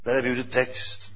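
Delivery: ordinary talk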